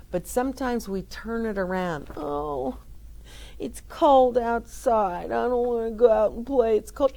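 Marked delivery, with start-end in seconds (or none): 2.2-7.1 a hammed-up complaining child; whiny kid. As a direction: play a kid grumbling about going outside.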